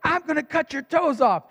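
high-pitched mimicry of an angry son's threat; mock kid's yell, pitched well up; yelling child. Son's threat to his mother repeated, imitated yell. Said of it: "he yells at her".

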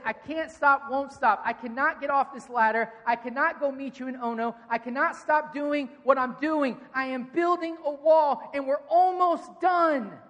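shouted, building defiance toward the wall's end; fierce declaration. Acted. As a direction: preach it like a man refusing to come down. Nehemiah preached at full force.

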